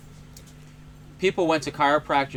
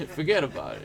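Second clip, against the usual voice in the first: put-on Sicilian accent. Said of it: "I can't do a Sicilian accent".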